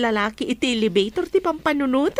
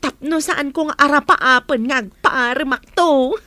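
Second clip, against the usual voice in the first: usual speaking voice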